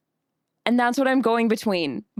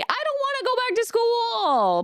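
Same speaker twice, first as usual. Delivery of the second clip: mock whining; 11 semitones higher than usual. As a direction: whine like a kid dreading school. Playing a student dreading school.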